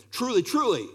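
solemn repeated "Truly, truly"; doubled emphasis. Shown as a demonstration. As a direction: lift and press both "Truly"s for emphasis. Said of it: "there's an emphasis being made".

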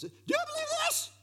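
very high, quick playful question; fast falsetto challenge; incredulous repeat. Acted. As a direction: squeal the question in comic disbelief. Repeats the question, then laughs.

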